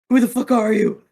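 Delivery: confused thrall yelling at a stranger; angry confusion. Acted. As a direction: demand it in a panic.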